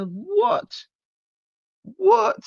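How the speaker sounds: very high-pitched disbelieving squeak; baffled high yelp; incredulous. Real, not acted. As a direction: shoot way up in pitch, questioning. Incredulous reaction to her excuse.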